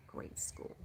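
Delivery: whispered echo to herself. Real, not acted. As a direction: whisper it back softly. Repeating softly to herself.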